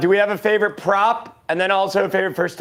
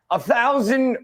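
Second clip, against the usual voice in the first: put-on Australian accent, higher-pitched; 4 semitones higher, slower than usual. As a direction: read it out like a drawling Aussie caller. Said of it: "rate the Australian accent".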